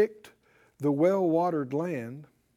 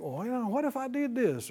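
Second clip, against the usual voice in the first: higher, softer imitation of someone wondering; mock wistful pondering, pitched up; tempted musing; 5 semitones higher, 6 dB quieter than usual. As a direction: muse aloud like a restless what-if thinker. Voices someone wondering about leaving.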